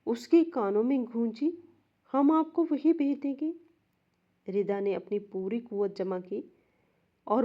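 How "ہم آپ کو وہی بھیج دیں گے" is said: put-on higher, sweet little girl's voice; remembered girl's voice echoing in her ears, innocent; innocent child voice. A narrator's reading. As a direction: sound like an innocent child with a chilling line. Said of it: "زینب کی معصوم آواز".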